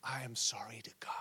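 low, breath-only apology in prayer; hushed, humbled retelling; whispered confession. Retold or imitated. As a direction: whisper it as a penitent's quote. David's apology to God, voiced.

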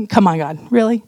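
ordinary talk, nothing marked

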